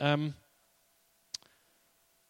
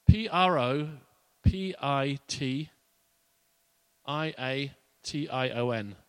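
"P R O P I T I A T I O N" spelled letter by letter, slow; spelled out slowly; slower than usual. Shown as a demonstration. Pastor spells the word for the congregation, slow. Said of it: "spelling the word".

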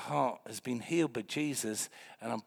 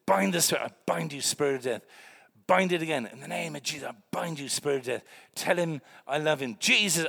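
fervent prayer; 3 semitones higher, 7 dB louder than usual. Retold or imitated. Retelling fervent prayer over corpse.

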